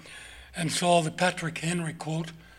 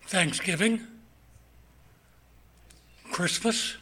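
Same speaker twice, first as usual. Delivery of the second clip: ordinary talk, nothing marked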